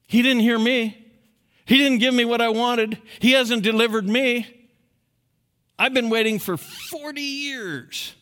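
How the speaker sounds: high, loud complaining doubter; mock outraged unbeliever; voicing unfaith. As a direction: shout the doubter's grievance. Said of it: "the arrogance of unfaith".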